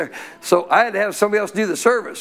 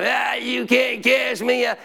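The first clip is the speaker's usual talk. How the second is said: higher, slow taunting demon voice; mocking demon; 6 semitones higher, slower than usual. Demon mocking the pastor, slow sneer. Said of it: "keeps laughing at me and talking".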